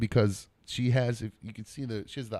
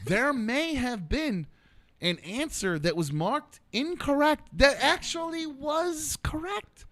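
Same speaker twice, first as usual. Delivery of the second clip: dramatic reveal; 10 semitones higher, slower than usual. Acted.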